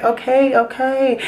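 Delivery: lightly mimicked, high-pitched agreement; retold placating. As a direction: imitate others backing off, voice up high. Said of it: "everybody else was like".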